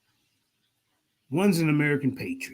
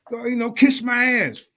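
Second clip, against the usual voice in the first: normal talking voice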